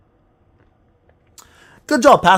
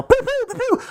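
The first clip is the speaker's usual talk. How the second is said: very high little toy-gun squeaks; pew-pew gun noises. Pretend. Streamer imitating a gun that only shoots blanks, squeaky pews. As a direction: fire off tiny, high-pitched pews.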